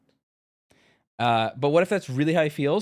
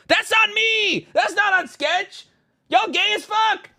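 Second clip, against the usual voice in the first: exasperated shouting rant; 4 dB louder than usual. Real, not acted. Streamer yelling at Twitter critics to chat, exasperated shouting. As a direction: yell it in disbelief at the critics.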